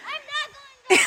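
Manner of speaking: yelling across the room. Real, not acted. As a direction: shout it down so mom hears. Child calling down to mom.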